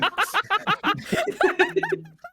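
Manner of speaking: high-pitched burst of laughter; real laughter. Real, not acted. Laughing at own wild recap, high and helpless. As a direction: crack up in a long, high laugh.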